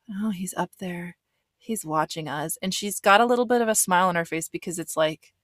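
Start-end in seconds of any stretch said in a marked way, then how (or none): 0.1-2.6 slow, hushed mock whisper; imitated whisper. Lexi telling the producer he's watching, slow whisper. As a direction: whisper it like someone might overhear. Lexi fearful in parking lot.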